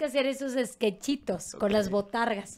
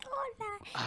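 muy aguda y suave, voz de niña; voz de muñequita; 15 dB quieter than usual. Shown as a demonstration. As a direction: saluda como animadora infantil. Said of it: "Y hablaba así". (voz aguda de muñequita infantil)

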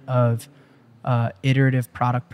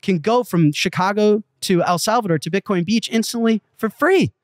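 excited pitch; 6 semitones higher than usual. Retold or imitated. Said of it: "I'm like".

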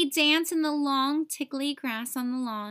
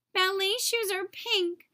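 wailing; 8 semitones higher than usual. A narrator's reading. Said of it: "Nate wailed".

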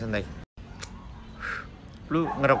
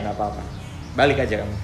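ordinary delivery

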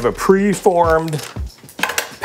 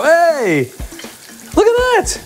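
excited; slower than usual. Real, not acted. Cook shows viewers the fried burger, high excited cry. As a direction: shout it out in triumph.

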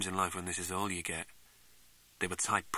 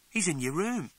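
put-on girl's reply; 9 semitones higher, 4 dB louder than usual. Girl answers the startled question.

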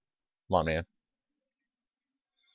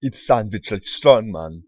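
put-on a clumsy Russian strongman accent, louder and slow; bad Russian accent; 2 semitones higher, 7 dB louder, slower than usual. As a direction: growl it like a cartoon Russian strongman, badly. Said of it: "I can't do a Russian accent".

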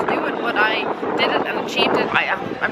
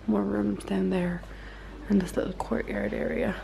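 normal talking voice

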